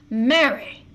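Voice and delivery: sharp loud call of her name; angry shout. Acted. Husband to defiant wife, a loud angry shout. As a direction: snap her name across the room. John's outburst in quarrel.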